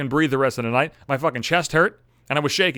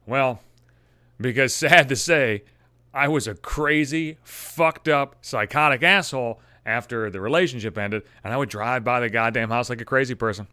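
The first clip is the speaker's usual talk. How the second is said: usual speaking voice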